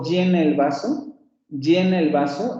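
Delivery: "llena" with a full, buzzing consonant y. Shown as a demonstration. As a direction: press hard on the y of "llena". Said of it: "ahí estoy haciendo una consonante plena". ("llena" con consonante plena)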